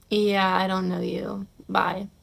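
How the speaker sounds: slow, soft brush-off ending in a whispered bye; cool dismissal. Pretend. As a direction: shrug them off slowly and quietly. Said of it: "If you would've been like".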